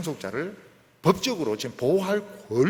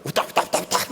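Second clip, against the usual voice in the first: mock chant of 우다 우다, louder and higher; hammed-up glossolalia chant; imitating tongues; 4 semitones higher, 5 dB louder than usual. (방언을 흉내내며 우다 우다)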